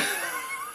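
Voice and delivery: sharp squeaky laugh through pain; pained high laugh. Real, not acted. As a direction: burst of laughter while hurting.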